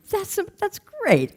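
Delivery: high, slow retold amazement; amazed approval. Leader hearing Ronnie is happy, amazed. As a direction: draw it out high, marveling at the news.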